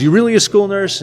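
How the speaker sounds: high, doubtful Boston guy; Boston accent. Retold or imitated. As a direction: pitch up into a Boston guy asking. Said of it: "in my worst or best Boston accent".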